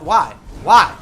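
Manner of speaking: loud exasperated shout of why; frustrated yelling. Real, not acted. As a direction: yell it out, fed up. Ranting at trolls on stream.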